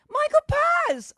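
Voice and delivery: very high, loud cry of greeting; excited call-out. Real, not acted. Host spotting a viewer online, high excited shout. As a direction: shriek the name in greeting.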